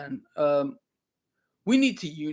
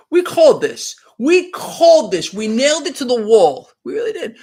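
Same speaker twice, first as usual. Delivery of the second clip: loud, higher, punched declaration; emphatic insistence; 4 semitones higher, 7 dB louder than usual. Real, not acted. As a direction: raise the voice to drive the claim home. Proudly insists they predicted it.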